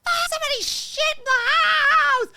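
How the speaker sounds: full-volume, high-pitched scream. Acted. Dog owner yelling about a mess, screaming. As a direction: scream it out, stretching the last word. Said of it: "still running around screaming with my dogs".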